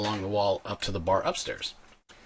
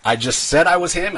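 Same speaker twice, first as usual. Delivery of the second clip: ordinary talk, nothing marked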